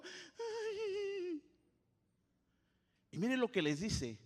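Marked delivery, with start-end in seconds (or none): 0.0-1.4 high squeaky scared ay; frightened yelp. Acted. Mocking the frightened disciples, high fearful yelp. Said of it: "andaban como".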